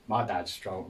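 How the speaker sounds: ordinary talk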